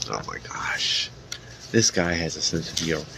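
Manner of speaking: a quick amused whisper under the breath; whispered aside. Real, not acted. Reader whispering an amused aside about the Witcher.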